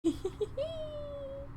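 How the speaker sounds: very soft, high little giggle. Real, not acted. Streamer tickled at being called adorable, soft high giggle. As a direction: giggle lightly, high and quiet. Pleased reaction to chat.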